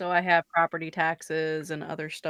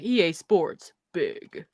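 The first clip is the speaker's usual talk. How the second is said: slogan announcer; 4 dB louder, much slower than usual. Retold or imitated. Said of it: "there was a point where it was like".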